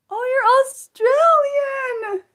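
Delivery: high, slow delighted cry; happy squeal. Real, not acted. Learning the viewer is Australian, squealing with delight. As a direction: gush with sudden joy. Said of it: "I got happy".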